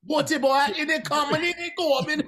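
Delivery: high, loud put-on celebrity impression. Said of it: "Chet is like".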